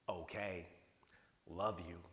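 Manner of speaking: "Okay, love you," dropped low and flat, each word falling like a chore; mock-resentful "Okay, love you,"; grudging read. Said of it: "here's an exact quote".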